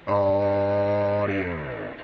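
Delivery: sideband sound on an AM receiver; a drawn-out test word turned into mush by the radio; garbled radio. Real, not acted. Sideband test call received as garble on the old radio. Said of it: "Now that's gonna sound funny".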